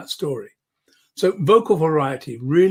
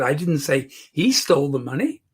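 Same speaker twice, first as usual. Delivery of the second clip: "he" raised, pointing to someone else; stress on HE. Shown as a demonstration. Coach showing she was the thief, stress on "he". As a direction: hit "he" as the wrong person. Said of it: "changing subtly the meaning".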